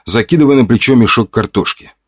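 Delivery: usual speaking voice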